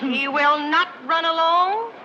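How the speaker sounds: slow, defiant weight on "not"; defiant emphasis. Acted. Daughter refuses to let him go, slow defiance. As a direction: spell it out slowly, leaning on "not". Daughter defies mother's dismissal of man.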